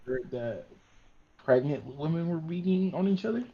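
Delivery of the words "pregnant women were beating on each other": usual speaking voice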